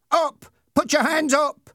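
very high, urgent shout; high-pitched bullying yell; shouted order. A narrator's reading. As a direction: yell the command at a captive. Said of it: "shouted Ernie".

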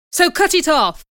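loud, high-pitched command; angry shout. Acted. Man ordering a girl's hair cut, shouting. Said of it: "he shouted".

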